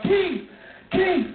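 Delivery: high, urgent calling out; panicked friend. Retold or imitated. Friend calling about the wrecked car, high and frantic.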